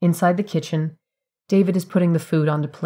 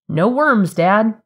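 exasperated; 5 semitones higher, 6 dB louder, much slower than usual. A narrator's reading. Arguing with father over sandwich.

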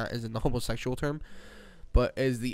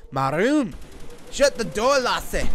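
loud, higher-pitched order; bossy yell; 6 semitones higher, 5 dB louder, slower than usual. Real, not acted. Ordering brother to close the door, loud yell. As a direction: yell it across the room.

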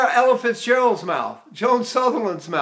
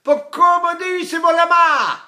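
cry-like Italian dialect; 9 semitones higher, 6 dB louder than usual. Shown as a demonstration. Teacher shows dialect is still crying, high loud wailed Italian. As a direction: stretch the dialect into a ringing cry. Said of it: "But it's still like this".

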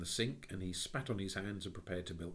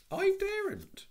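put-on high, bright child's voice; a high little-girl voice; 10 dB louder, slower than usual. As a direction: call out like an eager little girl. Jane's voice.

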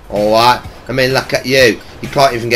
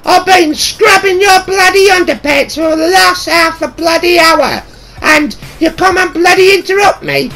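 high falsetto shrieking mum, loud; screeching mock mum tirade; scolding mum shout; 5 dB louder than usual. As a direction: rant like an outraged mother.

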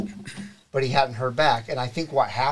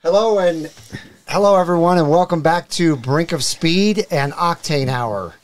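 higher and louder, upbeat welcome; show host opener; 3 semitones higher, 6 dB louder than usual. Acted. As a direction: open the show like an announcer. Host opens the live show.

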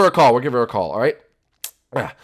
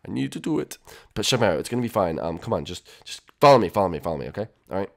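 normal talking voice

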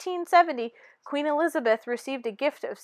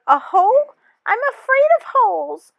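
high, loud frightened voice, much higher; fear; 9 semitones higher, 7 dB louder than usual. Acted. Tooth afraid of its cavity, loud frightened whimper. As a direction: quaver it out like a scared kid.

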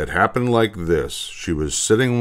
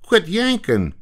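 put-on high-pitched boy objecting; protesting schoolboy; 11 semitones higher than usual. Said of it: "said Butch".

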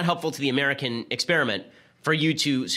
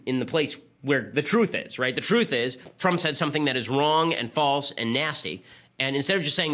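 ordinary delivery

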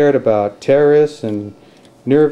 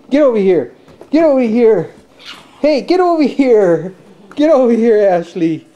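dad playfully calling his daughter back; 10 semitones higher than usual. Real, not acted.

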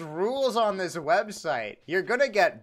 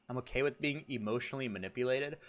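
normal talking voice

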